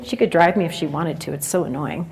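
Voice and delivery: mumbling. Acted. Sulky teen grumbling about mom, low mumble. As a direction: grumble it under your breath. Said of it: "I'm kinda mumbling".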